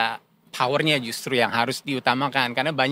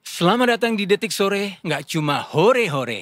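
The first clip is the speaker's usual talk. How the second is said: upbeat announcer opening, slowing at the end; lively opening; 5 semitones higher than usual. Shown as a demonstration. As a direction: open the show with energy, drawing out the tagline. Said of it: "Gaya sendiri aja ya?".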